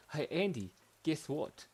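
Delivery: lightly voiced apple character. Apple greeting his friend Andy, lightly voiced. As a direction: hail a friend, a touch of character voice.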